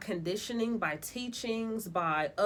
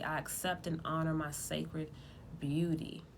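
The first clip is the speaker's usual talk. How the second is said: ritual incantation; 3 semitones lower, 5 dB quieter than usual. Shown as a demonstration. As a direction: recite it like a sacred vow. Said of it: "Place the red veil over your head and say".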